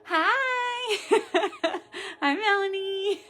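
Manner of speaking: high, loud, laughing hello; squealing hello with a laugh; excited greeting. Real, not acted. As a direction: greet her sister with delight. Twin sister waving hello.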